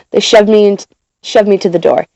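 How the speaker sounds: usual speaking voice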